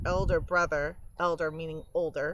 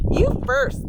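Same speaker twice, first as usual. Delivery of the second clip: high and loud tease; cheeky little brother; 8 dB louder than usual. Acted. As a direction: toss the dare back, squeaky. Brother dares back.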